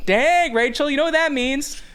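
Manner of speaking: high, loud folksy heckling; rodeo clown announcer. Retold or imitated. Clown announcer teases women about parking lot driving, loud mock hype. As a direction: rib the crowd like a hammy arena clown. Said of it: "basically a rodeo clown".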